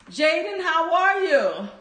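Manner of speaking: usual speaking voice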